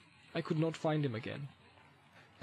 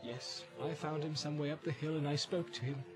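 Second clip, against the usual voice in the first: subdued, much softer account; hushed, guarded Boromir voice; hesitant answer; 3 semitones higher, 10 dB quieter than usual. A narrator's reading. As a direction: confess it quietly, with pauses. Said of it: "He answered slowly".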